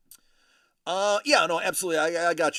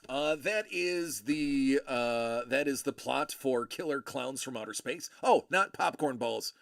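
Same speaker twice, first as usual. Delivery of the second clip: ordinary talk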